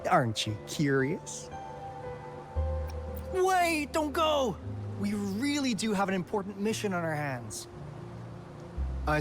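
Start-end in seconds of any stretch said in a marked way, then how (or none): 3.3-4.6 high-pitched hurried call-back; sudden high, pleading outburst; urgent plea. Acted. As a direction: call them back before they leave. Calling back a departing listener.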